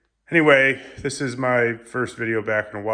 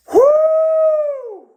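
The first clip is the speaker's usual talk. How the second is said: loud high whoop, out of breath; breathless whoop; 8 dB louder than usual. Real, not acted. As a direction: let out a winded, excited whoo. Gasping whoop after exertion.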